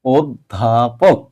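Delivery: অধ্যাপক slowly, as ওদ-ধা-পোক; correct pronunciation. Shown as a demonstration. Teacher giving correct form, অধ্যাপক slowly syllable by syllable. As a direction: sound out অধ্যাপক by syllable with closed o. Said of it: "অধ্যাপকের সঠিক উচ্চারণ হচ্ছে".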